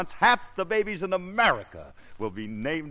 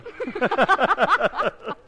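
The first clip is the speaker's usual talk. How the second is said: loud high laugh; big burst of laughter; 6 dB louder than usual. Real, not acted. Man laughs at absurd test.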